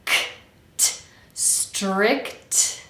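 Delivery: crisp released "K", "t", then "strict" drawn out; slow separate consonants. Shown as a demonstration. Coach finishes breaking down strict, slow isolated sounds. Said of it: "pronounce every consonant slowly".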